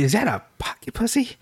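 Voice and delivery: high, hushed outraged question; hammed-up high scandalized girlfriend; mad girlfriend. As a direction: catch him in the act, appalled. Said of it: "first she was mad".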